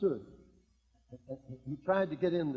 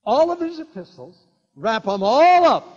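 preaching burst; 5 semitones higher, 13 dB louder than usual. Real, not acted.

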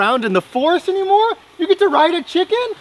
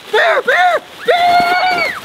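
high shouted bear, bear; dad shouting at the pretend bear; panicked yell; 3 dB louder, much slower than usual. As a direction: scream at the bear to scare it off.

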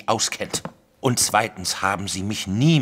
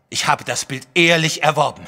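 higher and louder in offended protest; indignant protest; 6 semitones higher, 5 dB louder than usual. Acted. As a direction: bristle at the accusation. Wilson rejects theft accusation.

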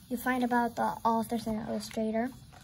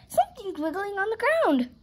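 high-pitched alarmed cry; alarmed yell; 9 semitones higher than usual. A narrator's reading. Katie warns of something wiggling, high alarmed voice. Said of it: "yelled Katie".

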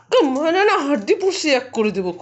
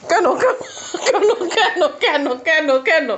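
playful squeal; 5 semitones higher than usual. Pretend.

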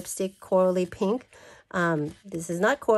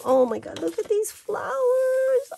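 delighted squeal. Real, not acted. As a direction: squeal over the flowers, stretched out. Delight at the flower papers.

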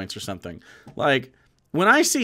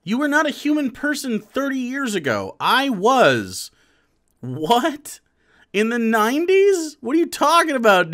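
loud, high-pitched disbelief; incredulous protest; 7 semitones higher, 4 dB louder than usual. Real, not acted. Streamer disputes a clip's claim about thirty years ago, incredulous. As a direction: object in exasperated amazement, voice raised. Incredulous reply to played clip.

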